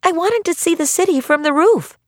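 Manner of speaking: put-on high, sweet innocent child voice. A narrator's reading. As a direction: keep up the wide-eyed innocence. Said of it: "in her most innocent voice".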